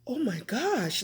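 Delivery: softened, scandalized exclamation; mock shock. Visitor reacting to dad's word, mock shock. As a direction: say it hushed, as if scandalized.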